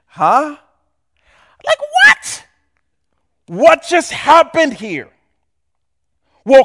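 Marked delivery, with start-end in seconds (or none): 0.1-5.1 mock disbelief. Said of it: "mock disbelief".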